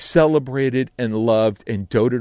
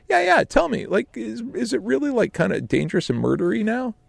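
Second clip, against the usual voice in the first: much higher, eager questioning; imitating Joe; 14 semitones higher than usual. Casting director Joe pressing Sam in a much higher, eager voice. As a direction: push the nosy question. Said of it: "he goes".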